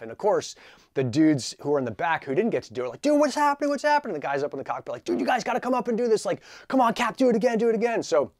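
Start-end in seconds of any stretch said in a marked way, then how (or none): 3.0-4.1 high, loud frantic asking; excited shouting. Retold or imitated. Passengers in the back yelling to the cockpit, high and loud. 5.0-8.0 high, loud giddy begging; excited hollering. Retold or imitated. Prisoners in the cockpit urging the captain to go again, high and loud. Cockpit guys hyping others.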